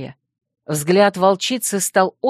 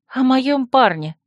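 higher, slow, sheepish answer; mildly abashed, pitched up; embarrassed; 6 semitones higher, slower than usual. A narrator's reading. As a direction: admit it awkwardly, drawn out. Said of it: "смущённо отозвалась я".

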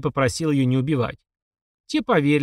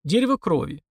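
plain reading voice

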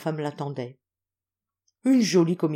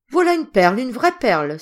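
louder, higher proud exclamation; exclaiming; 6 semitones higher, 8 dB louder than usual. A narrator's reading. Old general to his confidant, loud delighted exclamation. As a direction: cry it out in delight. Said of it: "s'écria-t-il".

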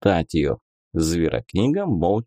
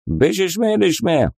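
gibberish migrant voice; 8 semitones higher than usual. Acted. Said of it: "Jamshut gibberish".

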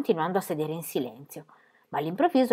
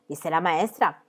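ordinary delivery

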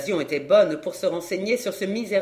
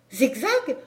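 rising, louder, pressing questions; agitated disbelief; 8 semitones higher, 4 dB louder than usual. A narrator's reading. Camille pressing the clown in shocked disbelief. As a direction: press him, stunned and urgent.

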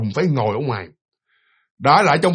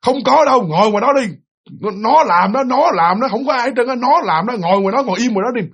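shaman yelling; 7 semitones higher than usual. Retold or imitated. Shaman yelling at the husband to stay outside. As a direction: bark him back to his seat. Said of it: "tiếng ông thầy ông la".

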